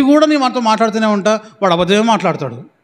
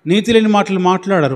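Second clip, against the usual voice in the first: ordinary talk, nothing marked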